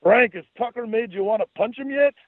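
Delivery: high, hammed-up goofy Scottish brogue; jokey Scottish accent, pitched high. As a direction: put on a silly Scottish voice to needle him. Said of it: "goofy Scottish accent".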